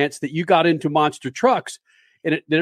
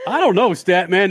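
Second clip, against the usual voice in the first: ordinary talk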